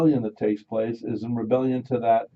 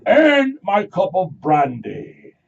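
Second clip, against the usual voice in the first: slow, much louder, much higher lordly shout; mock aristocrat; 8 semitones higher, 10 dB louder, much slower than usual. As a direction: bellow the order like a pompous lord. Mock stuffy British aristocrat.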